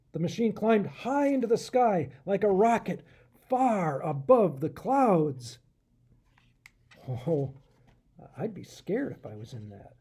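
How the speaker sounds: ordinary delivery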